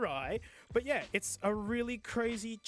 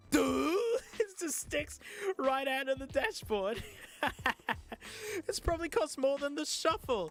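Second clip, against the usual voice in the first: laughing disbelief; 7 semitones higher than usual. Real, not acted. Incredulous laughter at cable.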